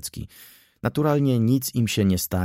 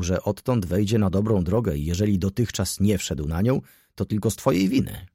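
ordinary delivery, nothing marked

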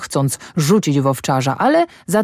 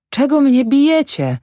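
high-pitched, bewildered protest; astonished question; 10 semitones higher, 3 dB louder than usual. A narrator's reading. Farmhand asks the man beating him why, astonished. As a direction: ask it high, in real puzzlement. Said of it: "zapytał zdumiony Maciek".

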